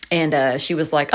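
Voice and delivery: ordinary talk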